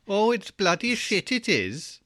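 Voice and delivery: high, drawn-out mock Welsh lilt; Welsh accent. Co-host teasing the host's moan as Welsh, hammed-up Welsh accent. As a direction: grumble it slowly in a sing-song Welsh accent. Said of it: "In, in a Welsh accent".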